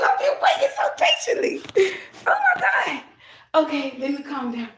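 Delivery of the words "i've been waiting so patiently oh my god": much higher, airy laughing; breathless laughing joy. Real, not acted. Thanking the spirit after waiting so long, breathless joyful laughter. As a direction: gasp it out in joy.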